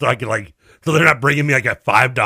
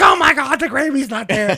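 high-pitched, loud mock panic; mom freaking out; 13 semitones higher, 3 dB louder than usual. Son retelling his mom's missing-gravy meltdown, mock freak-out. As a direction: imitate a mother in a flap, pitched way up. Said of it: "starts freaking out".

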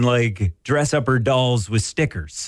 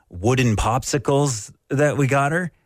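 ordinary talk

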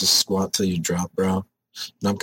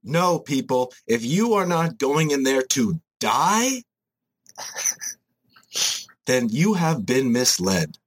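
preachy gym bro; 4 semitones higher than usual. Acted. Preaching hardcore lifting creed.

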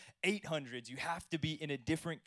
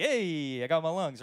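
excited; 3 semitones higher, 9 dB louder than usual. Retold or imitated. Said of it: "I'm like".

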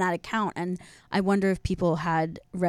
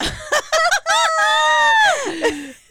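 loud high burst of laughter; 8 dB louder than usual. Real, not acted. Big laugh at blunder.

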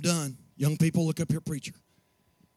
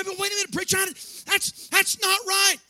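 much higher indignant voice; mock protesting congregant; 14 semitones higher, 4 dB louder than usual. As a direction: play the one who objects.

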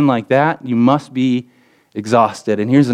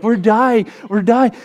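high, hammed-up panic cry; mock screaming; 8 semitones higher, slower than usual. Emily in the back of the RV, mock screaming. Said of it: "screaming bloody murder".

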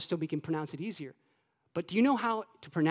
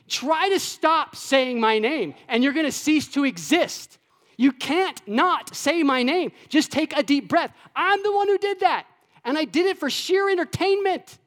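playful God; 7 semitones higher than usual. Acted. Preacher voicing God teasing his listeners, playful and high. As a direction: play God boasting with a grin. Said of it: "God's like".

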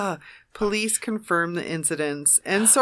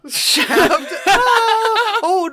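high burst of laughter; laughing; 12 semitones higher, 5 dB louder, much slower than usual. Real, not acted. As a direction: crack up on the word. Laughing at joke.

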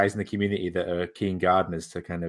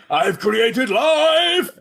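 booming, drawn-out high shout; hammed-up roar of creation, stretched slow; mock-godlike proclamation; 13 semitones higher, 8 dB louder, much slower than usual. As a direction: cry it out like a triumphant creator. Mimes godlike creator crying out.

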